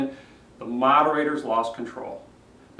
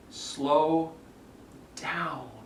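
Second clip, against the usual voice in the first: slow, spaced-out words dropping softer; slowing right down; 4 dB quieter than usual. Shown as a demonstration. Coach telling a rushed speaker to ease off, slowly and softly. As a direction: let each word land after a long pause.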